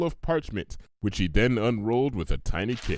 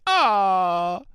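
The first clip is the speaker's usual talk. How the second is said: high, cooing aww; touched aww; 9 semitones higher, 5 dB louder than usual. Acted. Pinkie charmed by Applejack's kiss offer, high coo. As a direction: melt at the offer.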